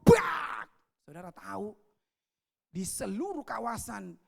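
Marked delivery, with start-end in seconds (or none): 0.0-0.7 a loud, sharp "brak!" crash noise; vocal crash effect; crash imitation. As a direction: smack out the sound of the canopy collapsing.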